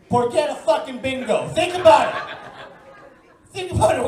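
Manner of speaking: bang it out as the answer; punchline shout. Acted. Punchline blaming porchetta bingo.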